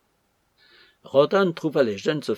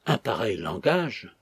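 plain reading voice

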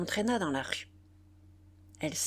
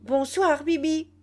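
much higher and louder, mocking; shrill mocking cry; 8 semitones higher, 6 dB louder than usual. A narrator's reading. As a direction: squeal it like a street kid. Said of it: "cria avec un accent suraigu".

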